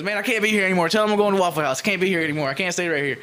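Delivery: high, loud urgent insistence; imitated agitation. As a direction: insist like a rattled man desperate to leave. Acting out victim desperate to leave.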